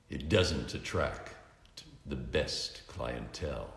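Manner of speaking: brief it coolly in the dark; grim Batman. A narrator's reading.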